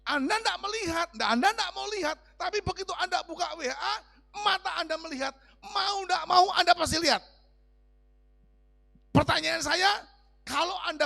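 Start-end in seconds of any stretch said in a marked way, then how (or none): 0.0-7.2 high, loud, insistent preaching burst; fervent preaching. Acted. Preacher warning his flock about phone pictures, shouting fervently. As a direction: hammer the warning at the congregation. Preacher drives point home loudly.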